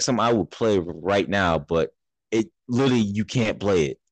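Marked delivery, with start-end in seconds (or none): none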